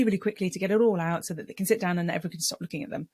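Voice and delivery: rushed, racing delivery; really quickly. Shown as a demonstration. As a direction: rattle it off to get it all out. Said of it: "they just start speaking really".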